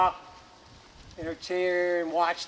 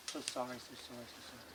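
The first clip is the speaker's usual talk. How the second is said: hushed apology; 6 semitones lower, 18 dB quieter, faster than usual. Acted. People apologising to the ground.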